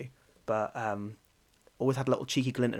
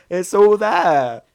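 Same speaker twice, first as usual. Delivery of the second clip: laughing; 10 semitones higher, 12 dB louder, much slower than usual. Real, not acted. Laughing fit mid-rant.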